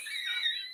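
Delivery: high, soft burst of laughter; real amused laugh, high and light. Real, not acted. Amused by her own story.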